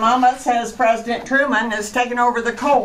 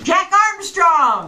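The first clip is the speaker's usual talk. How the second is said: announcer call; 6 semitones higher than usual. Acted. Radio show opener, called out.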